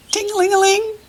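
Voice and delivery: very high trilling ring; phone ring. Shown as a demonstration. Teacher imitating an old phone ringing, very high and quick. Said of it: "That sound a phone makes is".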